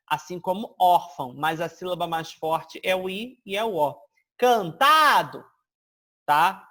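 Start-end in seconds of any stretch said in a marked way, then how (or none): 4.4-5.4 CANTADO slow and loud, TA drawn out; stress on TA. Shown as a demonstration. Teacher shows where the tonic falls, stressed can-TA-do. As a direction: punch and stretch the middle syllable of Cantado. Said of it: "mas a tônica é o tá".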